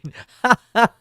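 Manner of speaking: quick high cackle; a burst of a laugh; short laugh. Acted. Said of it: "your Superman laugh?".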